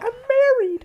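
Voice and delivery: squeaky high imitation of her; high falsetto quote; high mock woman. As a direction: pitch way up, mock offended. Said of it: "and she's like".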